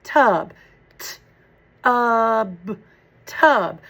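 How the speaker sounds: tub split into t, uh, b, then blended back; segmented sounds. Shown as a demonstration. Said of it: "Let's do the second syllable".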